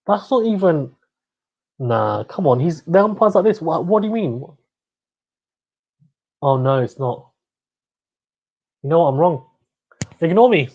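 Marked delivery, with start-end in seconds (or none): none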